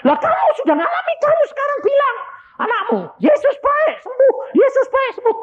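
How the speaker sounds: very high, strained preaching shout; fervent exhortation. Retold or imitated. Preacher urging parent to declare healing, fervent exhortation. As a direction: urge them on at a high pitch.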